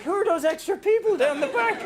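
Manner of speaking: mock panic, half laughing; laughing alarm. Nervous speaker spotting extra crowd, high laughing alarm.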